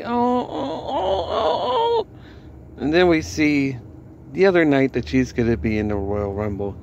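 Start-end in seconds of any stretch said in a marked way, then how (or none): 0.0-2.1 high hammed-up fake sobbing oh oh oh; mock crying. Vlogger mocking Mickie James, hammed-up fake sobbing. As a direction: fake sob like a whiny wrestler, very high.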